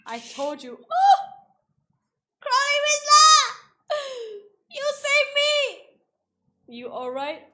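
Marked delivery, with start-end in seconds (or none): 0.7-1.2 very high, loud squeal; excited squeal. Real, not acted. Squeal at game character. 2.4-5.5 very high, loud fan squeal; excited squealing. Real, not acted. Fangirling over being saved.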